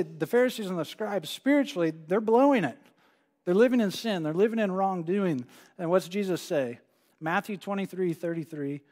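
normal talking voice

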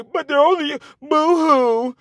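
exaggerated whimpering impression of a crybaby; mocking crybaby voice. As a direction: mimic a sniveling complainer to ridicule him. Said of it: "men come up and cry and say".

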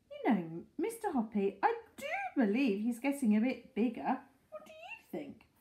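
put-on high fluttery old woman; old lady voice. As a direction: voice her as a chatty old lady. Mrs Silver voiced in story.

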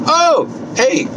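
usual speaking voice

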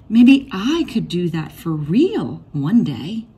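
ordinary delivery, nothing marked

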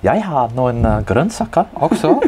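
spoken in Norwegian; playful Norwegian phrase. Acted. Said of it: "joke line spoken in Norwegian".

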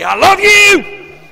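very high, drawn-out mock holler; bellowed declaration. Man declaring love to his wife, comically hollered. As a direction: belt it out, stretching every word.

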